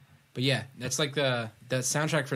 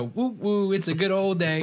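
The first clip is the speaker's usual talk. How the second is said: cheery train call; 7 semitones higher, 4 dB louder than usual. Retold or imitated. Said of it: "and I just go".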